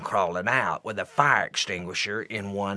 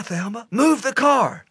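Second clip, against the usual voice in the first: repeated panicked order; 12 semitones higher than usual. Retold or imitated. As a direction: shout it again, hitting move. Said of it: "Harold said".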